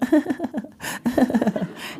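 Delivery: high, put-on laugh; demonstrated laugh. Shown as a demonstration. Mother coaching her girl's laugh, demonstrated high laugh. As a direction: show off a practiced, controlled laugh. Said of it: "It needs to be like this".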